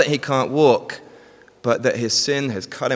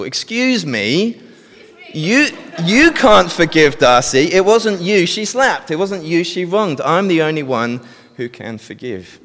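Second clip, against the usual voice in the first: higher and louder, slow, offended; slapped woman objecting, outraged; indignant protest; 6 semitones higher, 4 dB louder than usual. Acted. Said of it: "what is Rachel likely to say".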